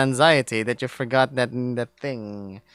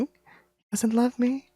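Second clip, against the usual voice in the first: high, slow and soft, voice cracking; breaking down crying; 10 semitones higher, 5 dB quieter, slower than usual. Acted. Deer realising the bear never loved him, crying softly. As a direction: let it fall apart as the tears come. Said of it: "My body is shaking".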